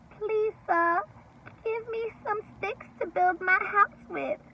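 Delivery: little pig voice. Acted. Second little pig asking the stick man, high voice. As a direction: ask sweetly like a small pig.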